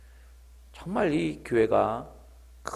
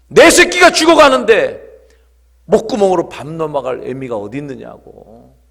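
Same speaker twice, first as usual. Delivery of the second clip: mother's anguished cry; 3 semitones higher, 14 dB louder than usual. Retold or imitated. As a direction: cry out for your dying child, then subside. Repeats the mother's outburst. (절규하듯 외치다 잦아드는 엄마)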